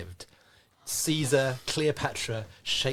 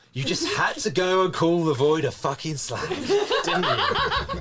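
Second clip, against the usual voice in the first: laughing; 8 semitones higher, 5 dB louder than usual. Real, not acted. Reading out while laughing hard.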